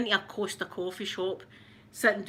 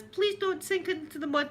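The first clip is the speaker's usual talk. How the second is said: put-on higher pleading child voice; pleading boy; 7 semitones higher than usual. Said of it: "whatever his name was".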